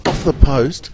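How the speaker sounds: sudden high, loud exclamation; excited near-miss call. Real, not acted. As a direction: jump up in pitch and volume at the near miss. Near miss in live play.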